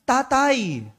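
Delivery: high, loud farewell cry; shouted goodbye. Acted. Boy shouting goodbye to his father, loud farewell cry.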